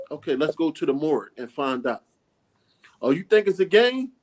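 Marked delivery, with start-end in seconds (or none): 3.0-4.1 challenging. Retold or imitated. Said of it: "challenging kids".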